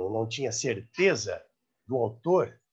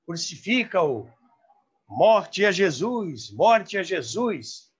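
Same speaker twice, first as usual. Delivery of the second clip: slow, louder chanted cry of a mob; drawn-out mock crowd yell; retold crowd shout; 6 semitones higher, 5 dB louder, slower than usual. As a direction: call it out like the crowd in the studio. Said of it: "a turma gritava".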